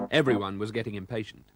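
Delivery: ordinary delivery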